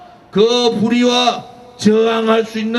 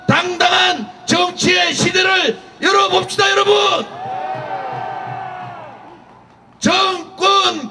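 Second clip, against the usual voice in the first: rally shouting; 6 semitones higher than usual. Real, not acted. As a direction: build up, then hammer each slogan. Rally speaker builds to slogans. (점점 고조되며 구호를 외치듯)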